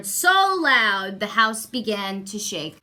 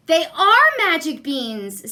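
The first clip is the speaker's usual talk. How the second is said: put-on excited boy; 6 semitones higher, 7 dB louder than usual. Jack excited about beans.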